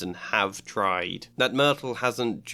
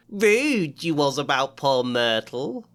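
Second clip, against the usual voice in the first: sly mocking poltergeist; 3 semitones higher, 4 dB louder than usual. Peeves mocking slowly.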